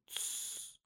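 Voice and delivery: very soft, voiceless "tsss"; hushing shush. Acted. Young man under the bed silences the other, whispered shush. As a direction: shush a panicking companion.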